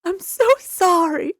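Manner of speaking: high, loud, slow mock-tearful apology; crying. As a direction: imitate a young doctor wailing an apology.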